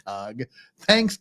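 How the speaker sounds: ordinary talk, nothing marked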